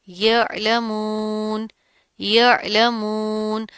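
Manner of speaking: "यअलमून" very slow, throat "अ" distinct, long "मून"; slow chanted "यअलमून", ending sustained; clear ain. Shown as a demonstration. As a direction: bring out the ain in "यअलमून" lightly, without a jolt. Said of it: "आइन की आवाज़ को आपने वाज़े".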